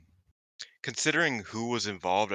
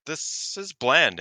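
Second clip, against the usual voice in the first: unimpressed; 7 semitones higher, 7 dB louder, much slower than usual. Retold or imitated. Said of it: "I was like".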